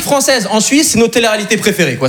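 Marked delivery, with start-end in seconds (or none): none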